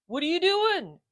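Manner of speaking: high, lazy dog voice; lazy bulldog voice. Acted. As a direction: voice the bored bulldog. Otis voiced in story.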